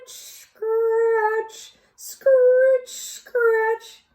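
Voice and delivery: high, drawn-out scratchy hoe noises; imitated hoe scratching. Teacher voicing the hoe scratching for the class. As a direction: rasp each scratch like a hoe in the soil. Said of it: "the noise of a hoe".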